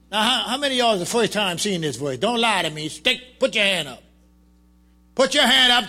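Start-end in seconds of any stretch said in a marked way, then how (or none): 2.9-5.9 loud, high call to the crowd. Real, not acted. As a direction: urge the hands up, louder. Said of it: "calling out to congregation".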